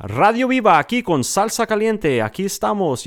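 high, loud Spanish radio announcer; radio host intro. Retold or imitated. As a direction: hype it up like a Latin radio DJ. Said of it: "So my intro was".

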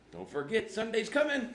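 high, loud frantic reminder; hammed-up deadline alarm, climbing sharply; mock panic. Pastor praying about Sunday's message, comic panic. Acted pressure before Sunday's sermon.

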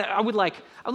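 ordinary talk

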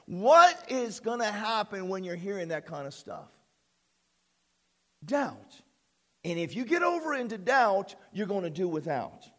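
ordinary talk, nothing marked

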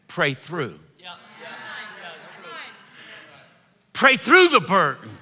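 put-on a touch of old Pentecostal fire; the preacher urging the church to pray through; old Pentecostal exhortation. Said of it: "They used to say things like this".

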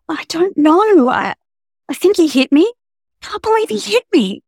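a frightened woman fighting back tears; hitch in voice. Acted. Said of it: "the hitch in [name]'s voice".

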